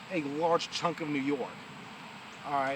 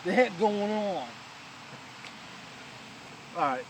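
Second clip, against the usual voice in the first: put-on New York accent; 2 semitones higher than usual. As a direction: play a New York guy. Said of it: "bring out my New York accent".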